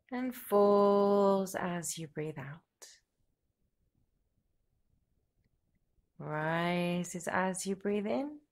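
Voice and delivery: slow, soothing yoga nidra voice; guided meditation. Real, not acted. Yoga teacher guiding listeners to relax with each exhalation, slow guided meditation. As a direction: lead them gently into the breath, with long pauses and drawn-out words.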